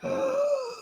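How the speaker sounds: a high sharp gasp; a quick, hammed-up shocked gasp; mock gasp. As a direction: imitate the whole table gasping. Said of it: "Everybody's like".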